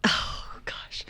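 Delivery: soft breathy "oh gosh"; hushed "oh gosh"; whispered exclamation. Real, not acted. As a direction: gasp it out in a whisper.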